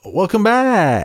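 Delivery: put-on French-accented spoof commercial; announcer welcome. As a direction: boom it like a radio host coming back from a break. Announcer-style podcast return.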